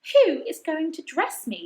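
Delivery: high, slow demand; high imperious demand. A narrator's reading. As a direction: insist on an answer, pitched high. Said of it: "demanded Mary".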